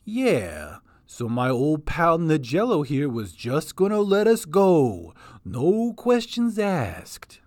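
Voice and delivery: slow, drawn-out charming voice; spell-casting persuasion. Acted. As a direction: lull him with the words. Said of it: "The little goblin was casting a spell".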